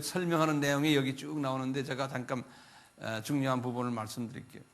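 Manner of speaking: usual speaking voice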